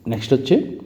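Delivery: normal talking voice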